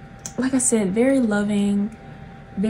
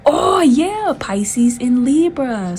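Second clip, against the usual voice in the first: high, loud, drawn-out delight; excited realization; 5 semitones higher, 5 dB louder, much slower than usual. Real, not acted. Tarot reader spotting the signs on the cards, excited. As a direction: stretch it out as it dawns on you, pitch soaring.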